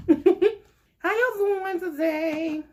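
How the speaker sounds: high slow drawn-out hello with a laugh; high laughing greeting; sing-song greeting. Acted. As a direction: stretch it out teasingly.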